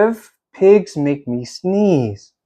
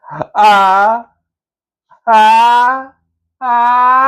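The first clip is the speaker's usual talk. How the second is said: rising ah ah ah before a sneeze; sneeze build-up; 5 semitones higher, much slower than usual. Acted. Gerald winding up to a sneeze, rising gasps. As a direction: climb higher on each ah.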